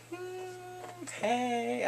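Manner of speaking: high, soft mock nonchalance; effortless little voice. She lifts ten boxes like nothing, airy. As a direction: toss it off lightly, high and soft. Imitating her lifting heavy boxes.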